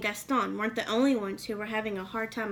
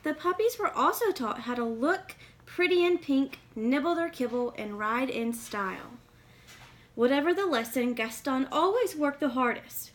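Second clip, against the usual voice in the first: put-on lively read-aloud for kids; storybook reading; 3 semitones higher, 5 dB louder than usual. A narrator's reading. Reading a picture book to young listeners, lively storytelling.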